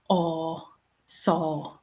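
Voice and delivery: a drawn-out "aw", then "saw" with the same vowel; long open vowel in "saw"; vowel demonstration. Shown as a demonstration. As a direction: sound out "aw" slowly and low-key, then "saw". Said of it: "the section of the word represented by".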